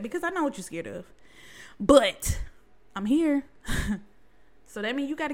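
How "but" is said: ordinary talk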